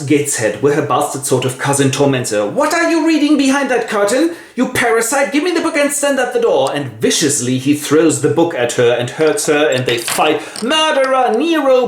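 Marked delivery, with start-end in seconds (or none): 2.5-6.7 high, sneering bully voice; bullying cousin. Acted. Spoilt cousin taunting Jane, high and sneering. 10.6-11.9 high cry. Acted. Jane shouts at cousin.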